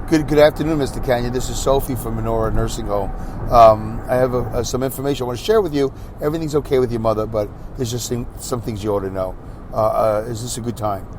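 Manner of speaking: polite nurse. Pretend. Nurse calling a son with gentle, soft news. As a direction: soothe the son gently, like a careful caller. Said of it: "she doesn't say".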